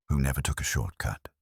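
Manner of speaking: usual speaking voice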